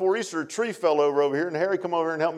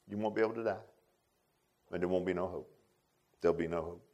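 softer, sombre repeat; hushed solemn warning about hell; quiet grave warning; 7 semitones lower, 9 dB quieter than usual. Acted. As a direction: let it sink low and heavy. Same warning repeated, dropped low.

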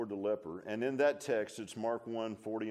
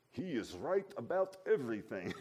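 light imitated thick German accent with a laugh; 2 semitones higher than usual. Old father telling the pastor in German accent. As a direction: mimic the old man's German accent, chuckling. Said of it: "He had a German accent, thick German accent".

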